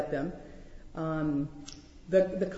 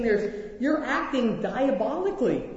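Jesus rebuking Peter; 5 semitones higher than usual. Pretend. Jesus telling Peter he is on Satan's team, a forceful rebuke. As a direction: scold Peter hard, man to man. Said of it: "He's saying".

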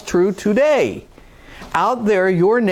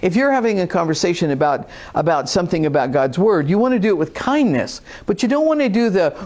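ordinary talk, nothing marked

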